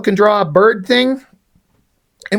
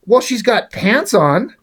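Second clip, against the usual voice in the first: startled high gasp at the comic page; shocked squeal; 6 semitones higher, slower than usual. Retold or imitated. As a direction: pitch jumps way up in disbelief. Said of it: "I almost went".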